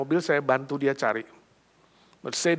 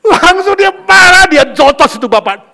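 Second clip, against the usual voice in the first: heated telling; 12 semitones higher, 15 dB louder than usual. A narrator's reading. Preacher tells the congregation how the mother hit the man, loud and heated. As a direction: drive the words home with rising anger.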